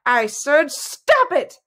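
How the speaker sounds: high, slow, emphatic shout; high-pitched insistent yell. A narrator's reading. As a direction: shout it, stretching the order. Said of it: "Eleonora shouted".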